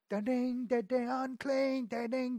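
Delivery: high, sing-song bell sound; imitated bell ding. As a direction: ring out like a leper's bell. Imitating the lepers' ringing bells.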